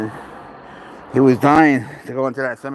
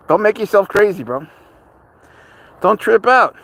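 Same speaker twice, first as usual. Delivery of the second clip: pleading with cat; 6 semitones higher, 3 dB louder than usual. Real, not acted. Calming panicking cat on leash.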